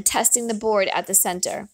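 plain reading voice

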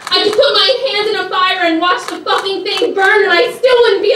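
desperate, high and loud, losing control; freaking out. Acted. Trapped inside her own head, freaking out. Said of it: "you went into this big emotional thing".

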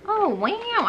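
sudden high delighted gasp; thrilled at the earrings; delighted surprise. Real, not acted.

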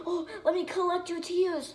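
high, soft mock teacher voice cooing over crying kids; lightly voiced teacher collecting tears, faked sweetness; mock creepy teacher. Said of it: "the teacher's like".

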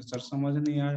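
usual speaking voice

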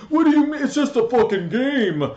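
high, loud, whiny imitation; mocking casual player. Gamer imitating a casual player who shrugs off losing, high and whiny. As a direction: squeal it like a dismissive noob.

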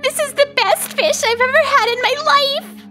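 high, gushing enjoyment; delighted. Acted. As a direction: rave happily about the taste. Delighted eating the fish.

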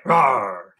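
a light bear growl; quick little roar; mild bear roar. Shown as a demonstration. Teacher reviewing the bear roar. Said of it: "growl like a bear".

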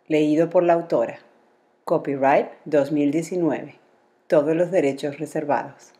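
put-on clear, bright title read to children; storybook title announcement; announcing the title. A narrator's reading. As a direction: present the book with a lift. Reads title and credits.

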